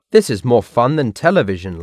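ordinary delivery, nothing marked

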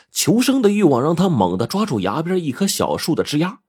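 plain reading voice

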